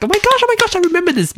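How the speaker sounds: very high-pitched squeal of recognition; excited recollection. Retold or imitated. Podcaster re-enacting hearing the old jingle, high excited squeal. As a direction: act out a thrilled flash of memory. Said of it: "And I was like".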